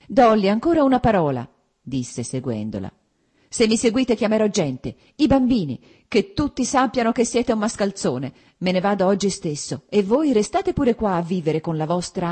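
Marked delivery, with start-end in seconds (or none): 0.1-1.5 urgent plea. A narrator's reading. Husband pleading as she leaves. 3.5-12.3 high, loud, threatening; angry threat. A narrator's reading. Wife threatening to call people, loud and furious.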